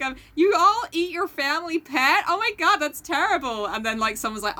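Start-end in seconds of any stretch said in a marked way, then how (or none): 0.3-3.7 high-pitched, shocked American impression; mock-horrified in a put-on American accent. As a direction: mimic an appalled American, pitched up. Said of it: "American people being like".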